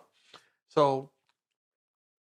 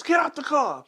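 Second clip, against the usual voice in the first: barked order; 12 semitones higher, 5 dB louder than usual. Retold or imitated. Cop ordering him out.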